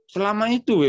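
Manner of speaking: high, loud startled "that long?"; surprised protest. Retold or imitated. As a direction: push it up loud in disbelief. Elder balks at long exam hours.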